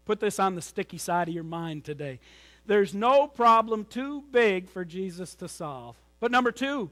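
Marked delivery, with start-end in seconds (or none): none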